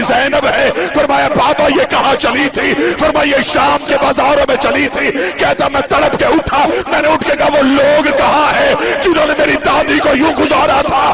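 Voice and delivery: preaching at full force. Acted. Reciter rousing the mourners to weep, with a loud wailing cry. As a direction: build the lament to a crying climax.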